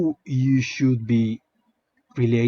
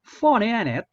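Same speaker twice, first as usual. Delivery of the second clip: put-on a hesitant Cockney accent, pitched higher; 10 semitones higher, 4 dB louder than usual. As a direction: try a sneering Cockney accent on the line. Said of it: "make a Cockney accent for this guy".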